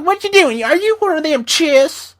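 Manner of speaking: put-on shrill old-mama screech, very high and loud. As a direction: imitate a loud, high-pitched Southern sitcom mama. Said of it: "He sounded like [name] from Mama's Family".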